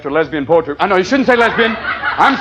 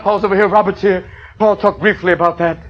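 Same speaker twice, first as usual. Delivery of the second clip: ordinary talk, nothing marked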